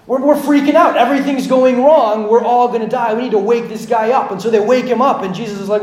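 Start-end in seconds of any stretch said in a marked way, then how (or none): 0.0-4.3 loud, high-pitched acted panic; freaking out. Disciples in the storm-tossed boat, loud acted panic. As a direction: play frantic disciples, raised voice spilling out. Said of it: "the disciples are freaking out".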